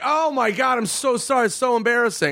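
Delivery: high fake embarrassed gush; mock apology. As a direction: overdo the sorry. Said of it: "And we're like".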